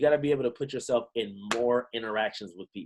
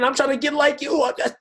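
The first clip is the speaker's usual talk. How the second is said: higher and louder, gushing excitement; gushing gym compliment; 14 semitones higher, 5 dB louder than usual. Retold or imitated. Member complimenting a woman at the gym, gushing. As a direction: gush like you're hyped to meet her.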